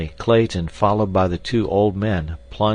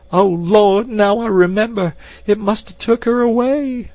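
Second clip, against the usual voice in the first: high wailing woman; 8 dB louder than usual. Acted. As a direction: wail like a terrified old maid. Voiced maid character.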